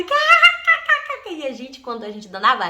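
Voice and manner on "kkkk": high, loud mock cackle; imitated shrill laugh. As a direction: cackle like the giggling sister.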